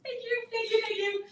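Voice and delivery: gracious thanks. Retold or imitated. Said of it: "he would have just been like".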